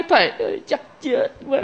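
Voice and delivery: faint, high-ending follower's wail; mock distressed plea. Dazed follower appealing to the monk, mock despairing plea. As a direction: trail up softly in mock despair.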